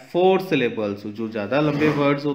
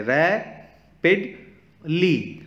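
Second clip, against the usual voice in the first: Rapidly stretched slowly over three separate beats, higher pitched; syllable by syllable; 4 semitones higher, much slower than usual. Shown as a demonstration. Teacher counting vowel sounds for kids, rapidly split syllable by syllable. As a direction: break rapidly into रै, पिड, ली with pauses. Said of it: "vowel sound निकल रहे हैं".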